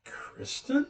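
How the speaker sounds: high, breathless gasp of disbelief; hostess's stunned, squeaky question; astonished. Retold or imitated. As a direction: say it as if your jaw just dropped. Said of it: "her jaw dropped, and she goes".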